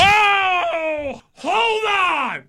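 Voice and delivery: high, shocked outcry; shocked shout. Real, not acted. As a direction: yelp out in shock. Shocked reaction to herpes.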